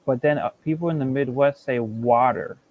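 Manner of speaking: ordinary delivery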